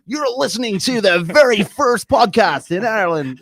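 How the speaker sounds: high, loud, drawn-out podcast announcer; Blind Boy. Retold or imitated. Podcaster introducing first podcast in Ireland, big announcer voice. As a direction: imitate a grand podcast intro slowly. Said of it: "Blind Boy does it really well".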